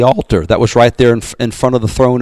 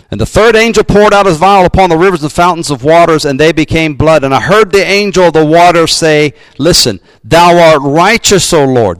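raised, ringing Bible reading; scripture reading; 4 semitones higher than usual. Acted. Pastor reading Revelation to the congregation, ringing proclamation. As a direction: lift the verse up like a proclamation.

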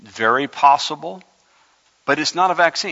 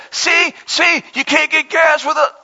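much higher, squeaky panicked alarmist; mocking alarmist; 13 semitones higher, 5 dB louder than usual. Preacher mocks people sending scare posts, high squeaky alarmist. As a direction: whine it like a conspiracy scaremonger.

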